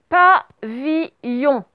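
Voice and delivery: PAVILLON very slow, each syllable set apart; syllable-by-syllable dictation. Shown as a demonstration. Teacher dictating the last word to pupils, syllable by syllable.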